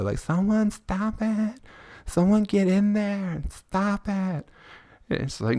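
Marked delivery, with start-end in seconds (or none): 0.2-4.5 high, slow pleading mock woman's wail; hysterical lady. Comedian mimicking street fight bystander lady, high pleading wail. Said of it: "that lady in every street fight video".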